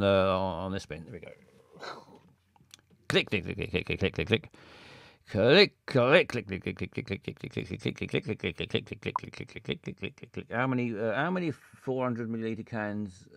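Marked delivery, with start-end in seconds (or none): 3.1-10.5 wheel ticking. Pretend. Voicing the wheel spinning down.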